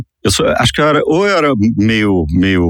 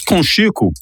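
talking while chewing; chewing noises mid-sentence; 9 semitones higher, slower than usual. Real, not acted. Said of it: "eu tô barulho de mastigada".

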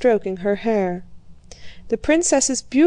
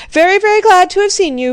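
forced hearty farewell; 10 semitones higher, 8 dB louder, faster than usual. A narrator's reading. Old prince ends the visit.

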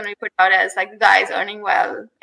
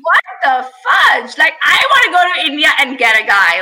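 raised, indignant comic protest; incredulous outrage; 5 semitones higher, 4 dB louder than usual. Retold or imitated. Woman reacting to friend's wife-shopping plan, incredulous outrage. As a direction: protest it in exaggerated disbelief. Acted outrage at buying a spouse.

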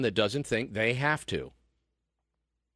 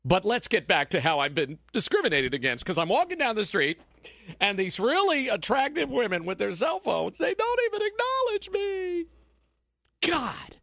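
lightly voiced whiny snowflake, much higher; sarcastic impression of a complaining millennial; mock whiny victim; 9 semitones higher than usual. Mocking liberal complaint.

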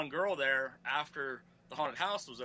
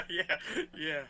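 laughing; 2 semitones higher than usual. Real, not acted. Laughing after the story.

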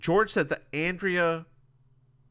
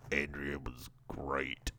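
low, hushed; 12 semitones lower, 11 dB quieter than usual. Acted. Recapper answers about Andrea's photos, low hushed voice. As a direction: drop right down and answer softly. Voicing Jess's answer to George.

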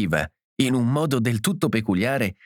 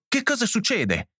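anxious insistence; 12 semitones higher than usual. A narrator's reading. John demanding to know what is happening, anxious insistence. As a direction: pitch leaping high, urgent question. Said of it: "pieno di ansia".

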